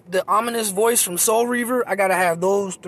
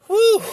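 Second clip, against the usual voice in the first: short, loud, high-pitched whew; 7 dB louder than usual. Real, not acted. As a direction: let out a high hoot of exasperation. Exhales after joking about voice.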